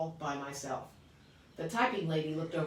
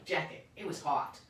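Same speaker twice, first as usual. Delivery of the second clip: ordinary delivery, nothing marked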